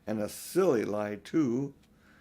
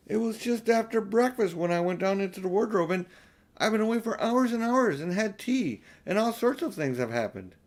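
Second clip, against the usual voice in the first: put-on higher, eager girl's voice; Lucy protesting, higher and urgent; young Lucy; 8 semitones higher than usual. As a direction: plead like an earnest little girl. Lucy insisting to siblings.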